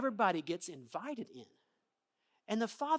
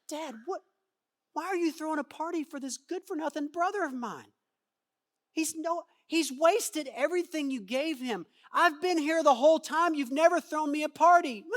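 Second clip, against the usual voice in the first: higher, whining complaint to dad; resentful older brother; 6 semitones higher, 6 dB louder than usual. Acted. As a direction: play the jealous son protesting the unfair party.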